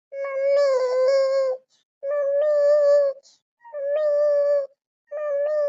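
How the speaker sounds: high wailing call for mummy; crying child. Acted. Fallen child wailing for mummy, crying. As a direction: cry out high, drawing out the word. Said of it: "ಅಳ್ಬೇಡ".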